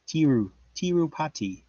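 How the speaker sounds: lightly attempted Indian accent on Tirupati. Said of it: "Indian accent".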